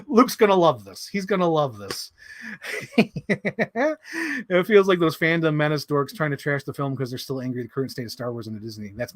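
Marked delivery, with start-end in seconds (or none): 2.3-4.4 giggle. Real, not acted. Laughing after joke.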